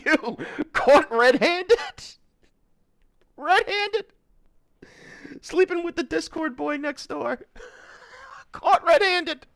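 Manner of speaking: high, loud gleeful mockery; cackling at the exposed couple; shrieking laugh. Real, not acted. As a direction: crow it through laughter. Mocking the caught cheater.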